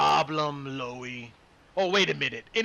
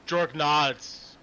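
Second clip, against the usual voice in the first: usual speaking voice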